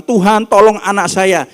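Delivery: loud, high cry for help; mother crying out to Jesus for her child; desperate plea. Acted. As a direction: call out to be heard over the crowd.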